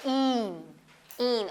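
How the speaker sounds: ईन with a long ee; drawn-out ईन, vowel held; long sound. Shown as a demonstration. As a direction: stretch the ee in ईन. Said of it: "long sound आप करते हैं".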